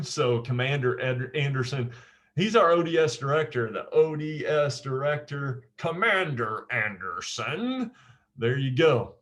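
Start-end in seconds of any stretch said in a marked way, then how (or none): 5.8-7.9 slow, lightly drawled agent voice; a hint of the Matrix agent. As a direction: lean into the name like the film agent, lightly. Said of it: "one of the agents talks like this".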